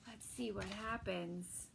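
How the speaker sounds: soft whisper to the listeners; whispered aside. Real, not acted. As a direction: lean in and whisper it. Reader's aside to children.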